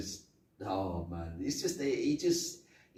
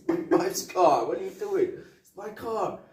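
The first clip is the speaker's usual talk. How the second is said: high, raised mock protest; exasperated protest; 9 semitones higher, 9 dB louder than usual. Acting Murtaugh defending his wife's car, exasperated. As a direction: protest in a pitched-up, agitated voice.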